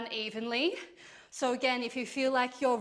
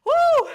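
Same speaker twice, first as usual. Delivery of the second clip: whoop; 14 dB louder than usual. Real, not acted. Quads burning during reps.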